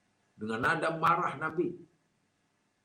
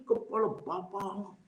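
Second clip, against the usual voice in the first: slow, mock-insulting jab; insulting intonation; 3 semitones higher, 4 dB quieter than usual. Shown as a demonstration. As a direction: drag it out as a taunt. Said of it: "Intonasi dia berbeza". (intonasi menghina, diucapkan lambat)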